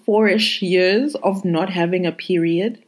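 normal talking voice

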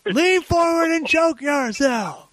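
high, slow, drawn-out barked command; mock drill sergeant. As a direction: stretch the order out like a movie sergeant. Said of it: "like in Full Metal Jacket".

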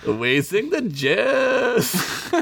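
high, very slow put-on weasel voice; weasel impression. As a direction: stretch it out in a silly high voice. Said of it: "best impression I do".

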